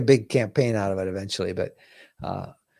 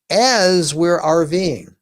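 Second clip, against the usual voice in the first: "as" stretched slow and lifted higher; stress on as; 4 semitones higher, 3 dB louder, much slower than usual. Real, not acted. Telling viewers the meal is cooked while driving, stressing "as". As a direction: lean hard on "as", dragging it out. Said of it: "And I stress the word as".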